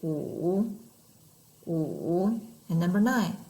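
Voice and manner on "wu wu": "Wu", each dipping very low then rising; third tone, dipping. Shown as a demonstration. Teacher trying number five in third tone, low dip then up. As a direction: sink "Wu" to the bottom of the voice, then lift it. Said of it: "lean your chin back a little bit".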